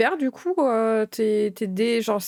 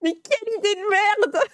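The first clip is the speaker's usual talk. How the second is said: laughing; 9 semitones higher than usual. Real, not acted. Song blasts mid-interview, he laughs.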